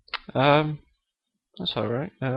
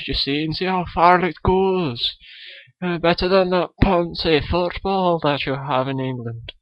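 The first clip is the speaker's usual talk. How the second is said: a kid's hammed-up Scottish accent; Billy Connolly impression; 5 dB louder than usual. Guest Billy Connolly greets the podcast host, mock Scottish accent. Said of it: "Billy Connolly is on the show".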